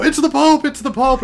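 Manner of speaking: high, loud mock crowd cries; crowd exclaiming. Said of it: "all these other people come over and go".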